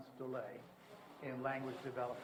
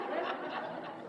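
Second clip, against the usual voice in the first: breathy high-pitched laugh. Real, not acted. Laughing at own baby talk.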